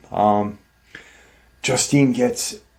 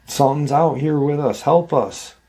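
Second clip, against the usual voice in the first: imitating a boy's cry for help, higher-pitched; mild retold scream; 5 semitones higher than usual. Youngest son screams to mom for help, mild mock scream. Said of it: "youngest son scream".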